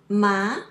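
ma rising like a question, loud; second tone. Shown as a demonstration. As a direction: sweep the pitch up on ma. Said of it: "It's different tones".

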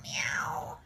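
soft slow "meow, meow"; slow and hushed, like a kitten; cat meow. Shown as a demonstration. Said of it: "I say meow".

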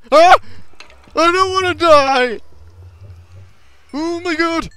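high, loud, slow wail of fear; drawn-out panicked scream; terrified wail. Real, not acted. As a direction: cry out like your life depends on it. Attacked by skeletons.

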